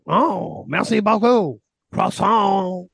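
playful hammed-up French Canadian accent; mock French accent. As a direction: toss off French words in a jokey French Canadian accent. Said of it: "Get your French Canadian accent".

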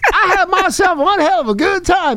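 high, strained put-on politician voice; Bill Clinton. Host joking as a famous man recommending a movie, high put-on impression. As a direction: do a high-pitched mock impression, gleefully seedy. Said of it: "I'm Bill Clinton".

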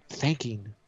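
ordinary delivery

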